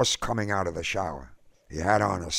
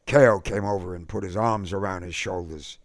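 ordinary delivery, nothing marked

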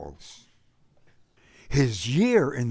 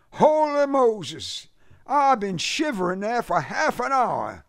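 complaining; 12 semitones higher, 4 dB louder than usual. A narrator's reading. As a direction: gripe loudly in a high Southern voice. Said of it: "he complained".

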